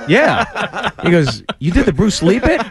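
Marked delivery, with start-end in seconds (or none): 0.1-0.9 high, drawn-out yeah; retold surprised yes. Comic recognized by his opponent, high surprised reply. 1.6-2.6 high-pitched imitation of fan recognition; excited fan. Attacker recognizing the comic, high excited question.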